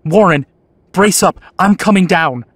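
loud, determined shout. Acted. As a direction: shout down to him firmly. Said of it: "I formed and shouted a resolution".

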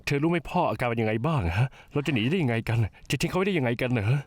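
upset over the phone. Acted. Phone quarrel about father.

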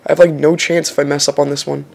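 normal talking voice

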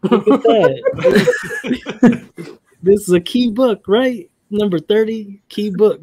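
high, loud, laughing with delight; giddy over a big pull; excited laughing. Real, not acted. Excited over a key book.

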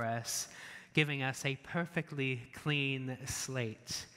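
measured, subdued prayer voice; public prayer. Real, not acted. Leads congregation in closing prayer.

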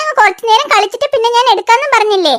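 ordinary delivery

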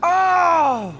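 high, loud cry of pain; frustrated yell. Real, not acted. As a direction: let out a sharp yelp of frustration. Killed in game.

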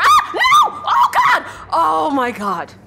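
very high, loud panicked shriek, then drawn-out relief downward; real startled scream. Real, not acted. Player startled while turning, high loud scream easing off. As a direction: scream at a sudden scare, then slow down.

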